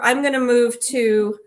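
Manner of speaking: ordinary delivery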